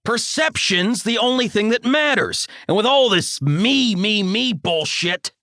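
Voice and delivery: high, scornful lecturing voice; ranting father. A narrator's reading. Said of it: "bombastic tirade".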